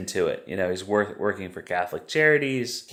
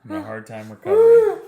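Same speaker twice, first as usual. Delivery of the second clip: much higher and louder, laughing half-cry; mock whimper; 7 dB louder than usual. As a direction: whine it through laughter. Mocking Priscilla's whiny reply.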